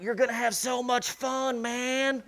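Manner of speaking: high, put-on stage-whisper voice; burly instructor's mock whisper. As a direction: tease the jumper with hammed-up reassurance. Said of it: "Burly guy's whispering in your ear".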